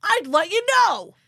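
loud, high Long Island dad yell. Retold or imitated. Ed yelling his refusal at the aliens, loud Long Island shout. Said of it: "my Ed voice".

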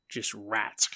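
hushed, eerie whisper; spooky whispered aside; creepy whisper. Acted. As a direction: breathe it like a campfire ghost story. Spooky storytelling about rat ship.

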